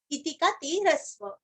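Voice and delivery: ordinary talk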